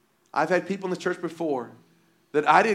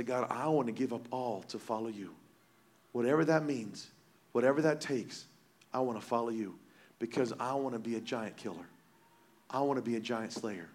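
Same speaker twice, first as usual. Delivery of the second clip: much softer and lower, sincere; humble surrender prayer; 6 semitones lower, 9 dB quieter than usual. Retold or imitated. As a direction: give it all up to God, quietly.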